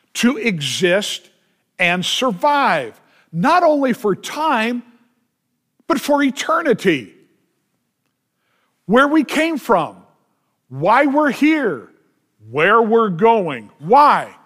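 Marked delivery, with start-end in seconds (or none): 3.3-13.7 rising pitch, slowing on each phrase; preaching crescendo. Acted. 13.8-14.4 loud why. Acted. Rhetorical question.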